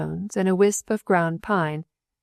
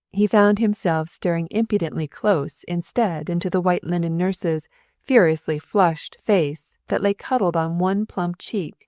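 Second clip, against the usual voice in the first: ordinary delivery, nothing marked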